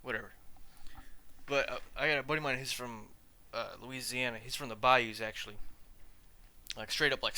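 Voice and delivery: normal talking voice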